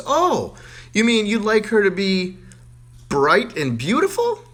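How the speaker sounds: high, loud sweet teacher; gushing teacher. Acted. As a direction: coo the guess hopefully.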